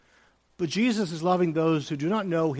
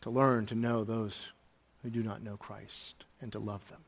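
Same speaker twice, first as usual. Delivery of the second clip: soft earnest appeal; 6 semitones lower, 11 dB quieter than usual. Real, not acted. Pastor softly urging believers to love outsiders. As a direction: lower and much softer, fading away.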